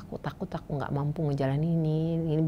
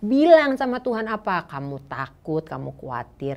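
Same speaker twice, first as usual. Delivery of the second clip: normal talking voice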